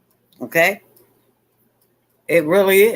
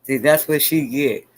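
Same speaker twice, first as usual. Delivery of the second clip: usual speaking voice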